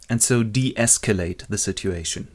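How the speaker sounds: usual speaking voice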